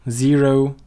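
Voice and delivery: loud, clear ZERO with a buzzed z; model pronunciation. Shown as a demonstration. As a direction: call out zero for the class to repeat. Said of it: "try to repeat after me".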